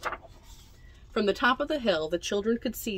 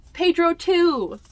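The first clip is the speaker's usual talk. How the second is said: high, loud delight; excited higher, louder delight; 9 semitones higher, 7 dB louder than usual. Real, not acted. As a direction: cheer them down the hill. Excited about sledding.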